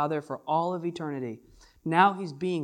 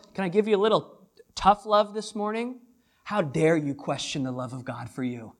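ordinary talk

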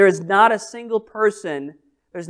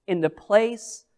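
soft reassurance; 3 semitones lower, 10 dB quieter than usual. Real, not acted. Closing reassurance.

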